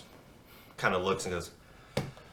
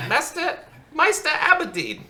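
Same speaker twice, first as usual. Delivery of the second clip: much higher, louder summoning call; Bushel calling out; 6 dB louder than usual. Acted. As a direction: call the name into an empty room. Bushel calls a missing member.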